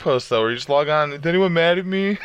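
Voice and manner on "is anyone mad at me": raised, plaintive mock pleading; hammed-up insecure question; mock needy apologizer. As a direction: imitate someone fishing for reassurance. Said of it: "used to talk like that".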